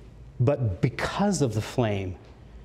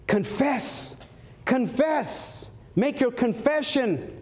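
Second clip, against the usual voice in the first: fervent crying out; 10 semitones higher, much slower than usual. Retold or imitated. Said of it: "crying out".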